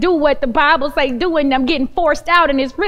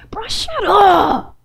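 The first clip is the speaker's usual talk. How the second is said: mock annoyed retort; 6 semitones higher, much slower than usual. Retorting to husband, laughing.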